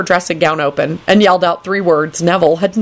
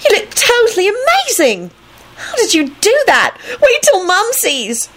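very high, gushing squeal; thrilled high little-girl voice; excited. A narrator's reading. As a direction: gush at the top of your range. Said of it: "Rosie yelled it excitedly".

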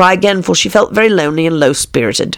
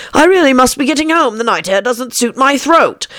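fussy magpie; 8 semitones higher than usual. A narrator's reading. Old magpie voiced in the story.

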